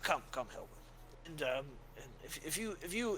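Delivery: put-on soft, high old cleric sending someone away; a kindly elder waving off a guard; gentle old priest. Father dismissing Ulfrig gently, softly and kindly.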